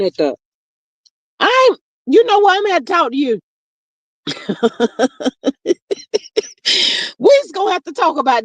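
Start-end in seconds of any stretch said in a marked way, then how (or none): 1.4-3.4 mock scolding. Real, not acted. Teasing a viewer in the chat, mock scolding. 4.2-7.1 long airy laugh, rising; breathy laugh. Real, not acted. Laughing at a viewer's cheeky comment, airy giggle.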